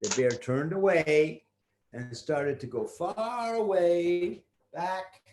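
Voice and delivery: plain reading voice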